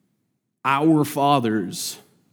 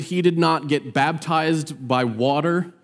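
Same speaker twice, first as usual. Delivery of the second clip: ordinary talk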